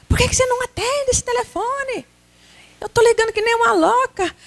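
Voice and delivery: high, scolding mother's outburst; angry mother. Acted. Mother scolds son for ignoring her calls, high and angry. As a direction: scold him for not answering, voice up high.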